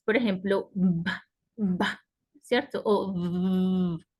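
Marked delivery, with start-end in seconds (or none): 0.7-2.0 short "ba", lips popped together; plain B sound. Shown as a demonstration. Teacher showing the B does not vibrate, short "ba". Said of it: "La B no vibra". 3.1-4.0 long buzzed "v" on its own; vibrating V. Shown as a demonstration. Teacher showing that the V vibrates, sustained buzzing v. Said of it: "La V vibra".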